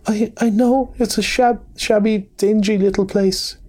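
high, stammering Mole, choking out words through sobs; tearful stammer, voice pitched high; sobbing brokenly. A narrator's reading. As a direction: break the words up like a crying little animal. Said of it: "he sobbed forth at last, brokenly".

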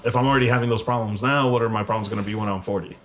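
normal talking voice

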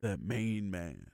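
low, slow tough guy voice; deep gruff catchphrase. Retold or imitated. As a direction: growl it like a brawler. Quoting Lobo's catchphrase.

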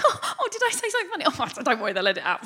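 high, quick, giggly false surprise; mock innocence. Comedian after stealing a punchline, feigning innocence. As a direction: play it coy, laughing it off. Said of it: "afterwards be like".

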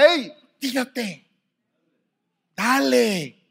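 high, slow, coaxing shove; mock pushy friend. A friend urging someone to leap: high and drawn out. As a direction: egg them on to jump, drawn out. Voicing someone giving a push.

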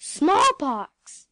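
loud shocked cry. A narrator's reading. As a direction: gasp out the dreaded word. Shocked exclamation.

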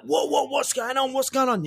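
high, alarmed what's going on; startled bar patrons crying out; panicked crowd. Acted. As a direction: onlookers in the cantina react to the gunshot, high and alarmed. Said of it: "People are like".

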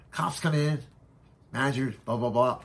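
usual speaking voice

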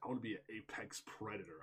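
soft, quick murmur of the quote; imitating a soft movie whisper; quiet quoted line. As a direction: hush it out fast like the film line. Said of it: "that line is so beautifully delivered".

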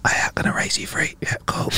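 hushed whisper bets at the table; whispered poker. Acted. Gambler at a silent poker table placing bets, whispering. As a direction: whisper it like a secret poker game. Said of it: "like whispering poker".

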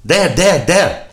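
high, loud, staccato cries; excited pointing boy. Acted. Little boy showing his mother the discovery, excited. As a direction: point it out triumphantly, shouting.

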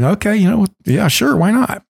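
eager yes. Retold or imitated. Eager reply to the old man.

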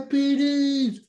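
high, loud cry of diabetes; yelled word. Retold or imitated. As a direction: shout diabetes in mock panic. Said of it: "he's yelling".

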